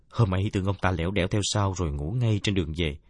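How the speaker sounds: ordinary delivery, nothing marked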